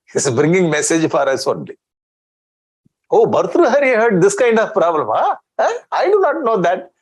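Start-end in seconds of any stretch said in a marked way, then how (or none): 3.1-6.9 high, feigned astonishment at the news; pitched-up sarcastic surprise; mock surprise. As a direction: play a doubter who pretends to be shocked. Mocking an imagined listener's reaction.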